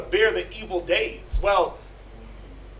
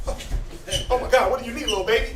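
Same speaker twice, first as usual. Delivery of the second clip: put-on doting baby talk. Doting new parent coos.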